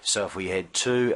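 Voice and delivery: normal talking voice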